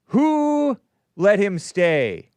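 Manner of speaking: long drawn-out high WHO, loud; loud, slow, pointed question; emphatic question. Acted. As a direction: stretch WHO out high, then land each word.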